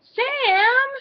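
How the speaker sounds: high, loud call of a name. A narrator's reading. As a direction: cry out after someone running off. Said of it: "Sarah cried".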